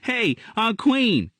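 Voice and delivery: put-on high, slow child voice calling out; a boy bursting in, cheerful; high, drawn-out kid's greeting; young boy Gerald. A narrator's reading.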